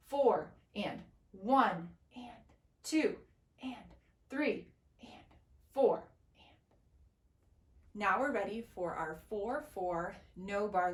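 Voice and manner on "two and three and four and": ands whispered, numbers spoken aloud; whisper counting. Shown as a demonstration. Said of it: "it's gonna sound like this".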